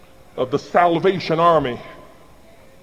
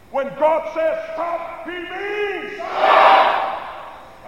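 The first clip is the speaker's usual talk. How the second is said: slow, stretched shout, much higher; booming call; 10 semitones higher, 5 dB quieter, much slower than usual. Shown as a demonstration. Preacher leading the congregation in a shouted refrain, booming. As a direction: boom out stop like a command. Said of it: "can you do better than them".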